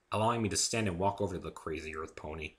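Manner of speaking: ordinary delivery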